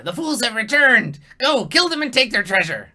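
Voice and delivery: high, loud squeaky kobold rallying his band; shrill goblin-ish battle cry; kobold war cry. Acted. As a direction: shout it like a gleeful little lizard chief. GM voices kobold leader.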